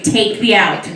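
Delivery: normal talking voice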